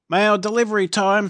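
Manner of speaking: high, loud guard calling out mail time; bright call of a jail guard; guard's call. A narrator's reading. Guard announcing mail to the prisoners, high and loud. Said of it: "says the guard".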